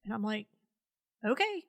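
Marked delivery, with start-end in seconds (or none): 1.2-1.6 high, rising doubtful okay; retold uncertain reply, pitched high; hesitant agreement. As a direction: lift it into an unsure okay. Retold reluctant reply to order.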